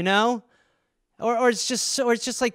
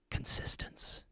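breathy whispered one-word tagline; dramatic ad whisper; 13 dB quieter, slower than usual. Pretend. Perfume commercial tagline, dramatic whisper.

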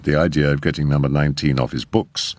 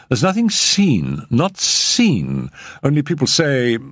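higher, eager nervous agent; hasty reassurance; 6 semitones higher than usual. A narrator's reading. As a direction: rush to smooth it over. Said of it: "Mister Radish hastened to assure her".

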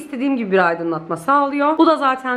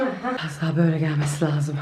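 usual speaking voice

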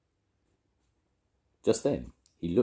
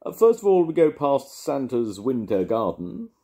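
calling out; 9 semitones higher, 8 dB louder than usual. A narrator's reading. Mister Brown reads the leaflet aloud to everybody, loud and higher. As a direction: call it out like a tour guide.

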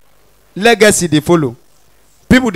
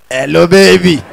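smooth pickup line; 4 semitones lower than usual. Acted. Yoruba boy greets girl.

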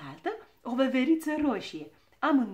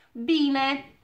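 loud, raised, threatening single word; menacing; 4 semitones higher, 10 dB louder than usual. A narrator's reading. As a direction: throw it out as a warning. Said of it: "zise habar n-am amenințător". (amenințător, cu glas ridicat)

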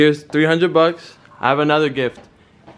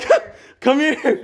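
much higher mock panic, breaking into laughter; fake rat panic; 12 semitones higher, slower than usual. Son keeps the fake rat prank going, laughing mock panic. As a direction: fake fear you can't keep a straight face through. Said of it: "I'm gonna pretend that there's a rat in here".

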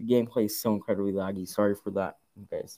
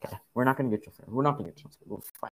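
ordinary talk

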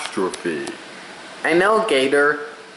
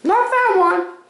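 squeaky toy voice. Pretend. Voice game, high toy voice.

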